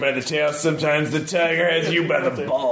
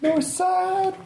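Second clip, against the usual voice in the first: high echo. Pretend. Echoing the GM.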